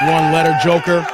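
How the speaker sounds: playful loud call-out over a cheap tip; mock scolding shout. Real, not acted. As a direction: bark it at him in jest. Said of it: "mock scolding over one-letter super".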